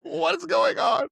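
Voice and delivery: very high, loud, slow mock wail; drawn-out retold cry of panic; comic panic. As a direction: ham up the bewildered despair. Acts out panic at arrest.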